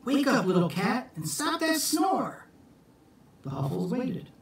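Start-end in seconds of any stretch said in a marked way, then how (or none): 0.1-2.5 character voice. Acted. Jack marvelling at the snoring kitten, high voice. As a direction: marvel at the tiny cat, much higher.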